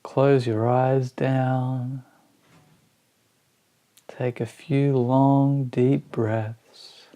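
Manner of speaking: slow, spacious meditation guidance with long pauses; guided meditation. Acted. Teacher guiding listeners through a body scan, slow meditation voice. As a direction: ease each phrase out slowly, letting it settle.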